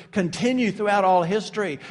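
ordinary talk

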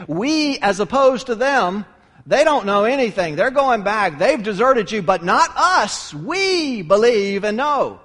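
loud, punched "we", stretched out; emphatic "we". Acted. Peter boasts to Jesus against those deserting, punching "we" loudly. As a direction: hit "we" hard and long against "they". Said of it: "if we make that emphatic".

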